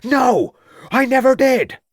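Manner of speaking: high, loud shouted denial. A narrator's reading. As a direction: yell it out, cornered. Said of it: "shouted Troutbridge".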